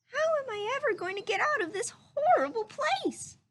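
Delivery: high, wailing mock sob; sobbing. A narrator's reading. Pinocchio locked in the cage, mild sobbing high voice. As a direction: wail it like a lost little boy. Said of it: "sobbed Pinocchio".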